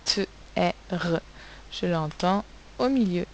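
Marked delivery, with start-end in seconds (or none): 0.0-1.2 "T, E, R" sounded as t, è, rrr. Shown as a demonstration. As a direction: break terre into "T, E, R". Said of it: "donc dans terre".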